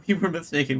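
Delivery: normal talking voice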